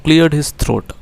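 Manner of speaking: slow, careful reading aloud of the English line; slow text reading. Shown as a demonstration. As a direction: read each word out deliberately for the class. Reads English text aloud slowly. (धीमे, साफ़ अंग्रेज़ी पाठ-वाचन)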